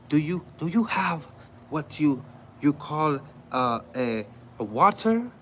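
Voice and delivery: high, halting, over-careful question; condescending foreigner-speak. Retold or imitated. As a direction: ask as if the listener barely knows English. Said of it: "doing accents like that".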